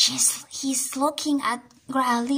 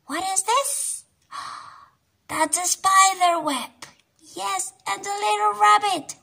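higher, eager pointing out; excited higher voice for kids; excited discovery; 10 semitones higher than usual. Acted. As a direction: gasp of delight at the picture.

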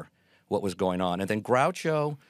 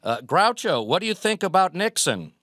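put-on much higher and louder, a keen thirteen-year-old; eager kid; 10 semitones higher, 6 dB louder than usual. Young Frank calling a question to Groucho, high and eager. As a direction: pipe it up like an eager boy. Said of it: "So thirteen-year-old Frank goes".

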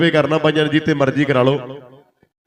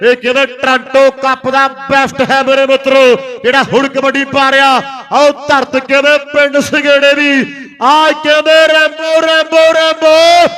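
frantic rural sports commentary; 6 semitones higher than usual. Real, not acted. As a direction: build the name into a roar. Building hype call for raider.

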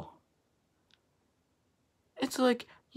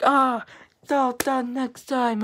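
loud, higher, drawn-out protest; exasperated retort; 4 semitones higher, 7 dB louder than usual. Retold or imitated. Sibling telling sister off over the Snapchat, loud and exasperated. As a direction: act out telling someone off, loudly. Acted angry reply to sister.

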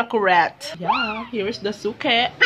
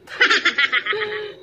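laugh; 8 semitones higher than usual. Real, not acted. Laughs at own story.

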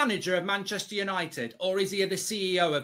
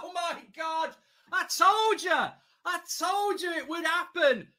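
ecstatic shout; 7 semitones higher than usual. Real, not acted. Celebrating the late winner.

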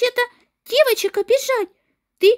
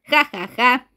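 sneering laugh; 7 semitones lower than usual. Acted.

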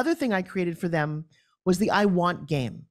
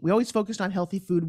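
ordinary talk, nothing marked

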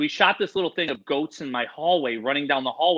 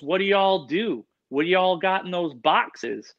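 slow Southern Indiana drawl, a bit higher; Southern Indiana accent; 4 semitones higher, slower than usual. Retold or imitated. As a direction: ask it like the nosy Indiana hotel kid. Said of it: "if you don't talk like that".

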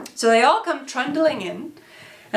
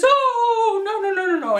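high, loud string of dismay; panicked father; 11 semitones higher, 3 dB louder than usual. Acted. Papa rushing in to stop the fight, acted panic. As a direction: wail in hammed-up alarm.

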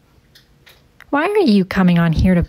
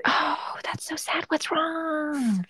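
soft breathy whisper; 7 semitones higher, 10 dB quieter, slower than usual. Acted. Streamer whispering to the crying caller in a hushed whisper. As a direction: whisper it back like a secret.

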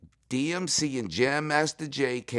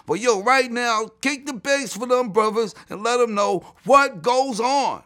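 high-pitched, building hype; hype-man intro; 7 semitones higher, 5 dB louder than usual. Acted. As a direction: build from raised pitch to loud, high urging. Re-performing his hype intro to Chuck.

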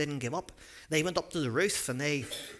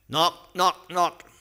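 loud, higher knock knock knock; knocking sound; 4 semitones higher, 8 dB louder, much slower than usual. Pretend. Storyteller to children, acting out loud door knocks.

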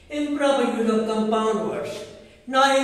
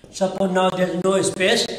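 usual speaking voice

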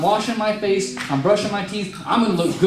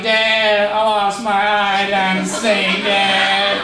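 put-on pleading child; 3 semitones higher, 3 dB louder, much slower than usual. Blind boy begging dad for help.

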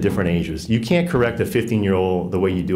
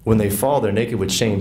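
ordinary talk

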